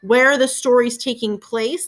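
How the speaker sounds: ordinary talk, nothing marked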